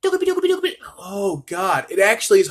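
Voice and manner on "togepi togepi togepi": squeaky-high rapid tongue twister; higher-pitched voice. Shown as a demonstration. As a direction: rattle it off way up high. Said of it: "do it in a higher pitched voice".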